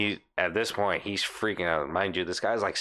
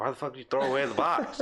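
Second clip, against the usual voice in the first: exasperated outburst; 4 semitones higher, faster than usual. Retold or imitated. Angry retort to the manager.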